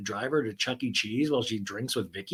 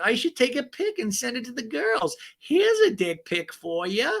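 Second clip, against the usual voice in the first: giddy Gina; 10 semitones higher than usual. Retold or imitated. Gina joking about sending a pic to the girls, high and cheeky. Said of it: "she's like".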